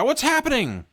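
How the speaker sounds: high-pitched panicked question; alarmed, squeaking-high yelp; frightened cry. A narrator's reading. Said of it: "you cry".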